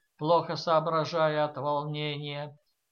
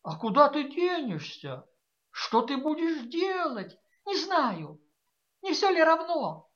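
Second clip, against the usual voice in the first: pleading daughter; 9 semitones higher than usual. A narrator's reading. A daughter begs her mother to leave with her, high and pleading. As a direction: beg like a frightened girl.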